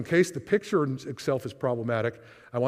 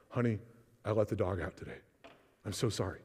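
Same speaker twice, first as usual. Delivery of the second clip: low, soft, sheepish confession; guilty husband; 4 semitones lower, 7 dB quieter than usual. Acted. Husband confessing to his wife about the dog, sheepish and low. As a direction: hang your head like a husband owning up to a tiny sin.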